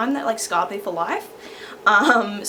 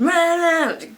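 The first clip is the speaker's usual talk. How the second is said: high, loud wordless imitation of a rant; wordless going-on-and-on noise; mimicked ranting noise; 9 semitones higher, 6 dB louder than usual. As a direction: blare out mock teacher nagging. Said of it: "going on and on and stuff".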